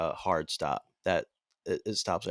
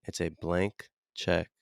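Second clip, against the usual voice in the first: ordinary delivery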